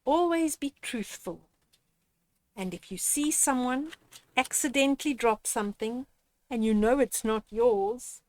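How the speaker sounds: ordinary delivery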